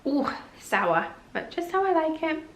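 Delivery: ordinary talk, nothing marked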